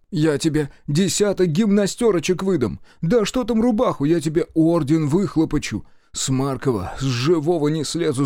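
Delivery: high, excited character voice; gushing supply officer. A narrator's reading. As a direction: promise lavish rewards in a high, eager pitch. (восторженный интендант, высоким голосом)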